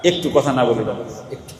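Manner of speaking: put-on soft fatherly plea to a child; gentle request. Shown as a demonstration. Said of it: "এই কথাটা কিন্তু ধমক দিয়ে বলা যায়".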